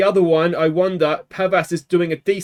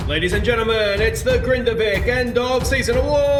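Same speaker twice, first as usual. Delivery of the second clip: high, announcer-style opening; mock awards host; 5 semitones higher than usual. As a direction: play the grand ceremony presenter. Opens award ceremony like host.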